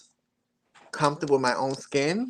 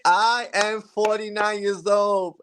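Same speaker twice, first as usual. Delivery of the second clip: laughing; 5 semitones higher, slower than usual. Real, not acted. Confessing age through laughter.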